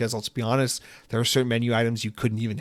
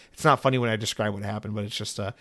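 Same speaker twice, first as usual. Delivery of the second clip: ordinary delivery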